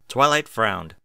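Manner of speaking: plain reading voice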